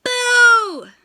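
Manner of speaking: loud high scare shout; big boo. Acted. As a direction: jump out and scare the wolf. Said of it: "and shouted".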